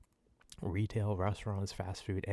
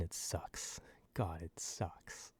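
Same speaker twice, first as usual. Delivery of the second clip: softly whispered, slowed exasperation; weary whisper; 3 semitones lower, 8 dB quieter, much slower than usual. Real, not acted. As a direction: sigh it out under your breath. Quiet exasperated aside.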